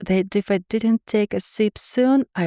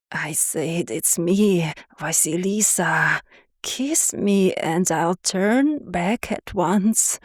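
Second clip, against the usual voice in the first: slow monster plea; slower than usual. A narrator's reading. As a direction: plead slowly through a monster's throat. Monster pleads for a kiss.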